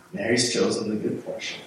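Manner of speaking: normal talking voice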